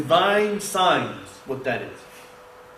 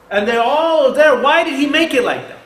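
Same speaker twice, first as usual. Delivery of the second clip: emphatic preaching; 7 semitones higher, 7 dB louder than usual. Real, not acted. Preacher urging the congregation, loud and emphatic. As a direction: press it home to the crowd.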